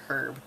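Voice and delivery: herb starting with a sounded h. Shown as a demonstration. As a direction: pronounce the h in herb. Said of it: "eventually I would start saying".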